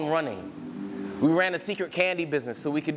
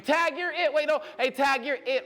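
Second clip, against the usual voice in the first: high-pitched playground yell, acted out; playground screaming; 11 semitones higher than usual. Boy to his friend racing across the playground, mock screaming. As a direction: call out like a kid playing tag, no real volume. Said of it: "we ran across the playground screaming".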